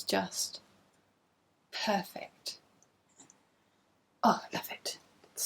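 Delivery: breathless whisper of awe. Real, not acted. Knitter admiring pink yarn to viewers, whispered. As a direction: whisper it lovingly.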